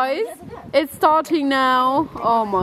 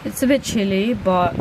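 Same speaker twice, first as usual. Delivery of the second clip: ordinary talk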